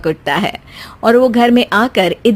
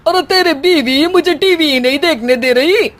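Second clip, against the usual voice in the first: complaining; 4 semitones higher than usual. Acted. Mother-in-law complaining to son.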